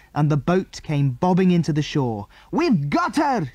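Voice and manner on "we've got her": high, drawn-out cry of triumph; triumphant shout. A narrator's reading. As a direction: shout the success, stretched and pitched up. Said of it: "shouted Andy".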